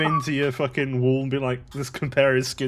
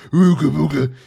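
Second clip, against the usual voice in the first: louder put-on caveman chant; mock caveman; 2 semitones higher, 8 dB louder than usual. Reading the Man Cave coupon code, mock caveman voice. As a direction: burst out as a silly caveman.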